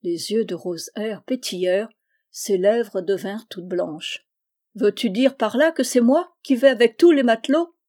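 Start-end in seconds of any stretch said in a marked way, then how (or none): 4.7-7.7 higher, louder indignant question; offended challenge. Acted. Girl asking whether he means her, outraged. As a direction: demand an answer, outraged.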